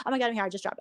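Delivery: ordinary talk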